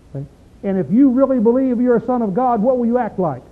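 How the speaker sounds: usual speaking voice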